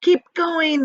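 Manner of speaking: loud, high encouragement; eager high shout; cried out. Acted. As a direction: urge him on. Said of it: "they cried".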